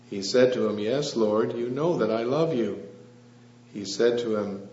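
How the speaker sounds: usual speaking voice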